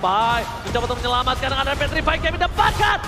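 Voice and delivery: high shouted triple-kill hype call; fighting game announcer. Real, not acted. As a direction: scream the play like a fight announcer. Hype esports play-by-play.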